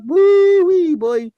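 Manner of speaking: high, loud Cajun "Woo-wee"; Cajun whoop. Retold or imitated. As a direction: holler it like a Cajun cook. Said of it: "He could cook".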